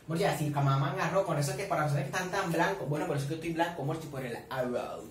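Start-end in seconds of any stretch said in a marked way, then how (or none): none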